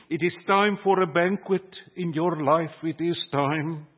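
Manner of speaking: ordinary talk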